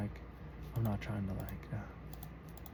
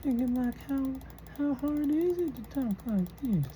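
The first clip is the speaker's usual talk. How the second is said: put-on high thin old man voice; elderly voice; 7 semitones higher than usual. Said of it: "I'm trying to get this voice just dialed in".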